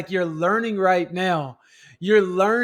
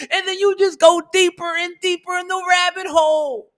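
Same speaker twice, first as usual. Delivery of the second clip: climbing higher and louder with each question until shouting; building to a shout; 7 semitones higher, 5 dB louder than usual. Acted. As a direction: wind it up step by step into a full preaching shout. Escalating rhetorical chain, self-talk.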